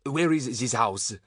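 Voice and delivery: put-on slow French-accented question, mildly anxious. A narrator's reading. Lost man asking a woman again, French accent, anxious. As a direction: ask slowly in a halting French accent. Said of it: "asked the man anxiously".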